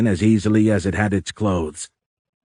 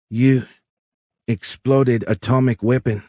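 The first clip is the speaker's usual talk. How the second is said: higher-pitched, halting alien accusation; 4 semitones higher than usual. A narrator's reading. Alien corrects Tony about the bomb, halting. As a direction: separate each word while searching for the next.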